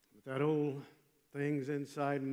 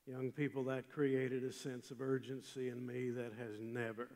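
ordinary talk

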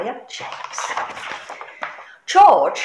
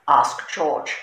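usual speaking voice

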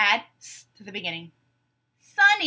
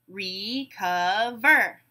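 "Recover" very slowly, re-cov-er; syllable by syllable; much slower than usual. Shown as a demonstration. Teacher breaking a word into syllables, beat by beat. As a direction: stretch "Recover" into three separate beats. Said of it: "we'll break it into syllables".

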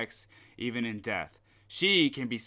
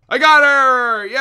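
much higher, much louder victory cry; triumphant shout; 12 semitones higher, 18 dB louder than usual. Real, not acted. Gamer shouting in triumph after catching her. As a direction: yell it out, you finally caught her.

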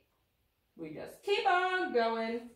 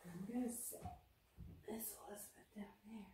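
whispered aside; 2 semitones lower, 16 dB quieter than usual. Real, not acted. Aside while setting puppet down.

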